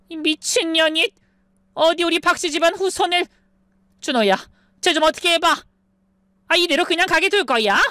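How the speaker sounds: put-on high shrill older woman, furious; raging mother-in-law. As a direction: scream insults, then whine to the son. Mother-in-law rages, calls son. (아들에게 매달리며 악쓰는 시어머니)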